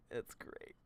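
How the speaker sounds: breathy whispered repeat. Real, not acted. Streamer whispering his reaction to chat. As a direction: drop to a whisper, amused.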